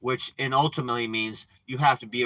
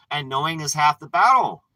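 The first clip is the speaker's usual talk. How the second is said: G I Joe slogan; 2 semitones higher, 7 dB louder than usual. Retold or imitated. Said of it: "Just like G I Joe says".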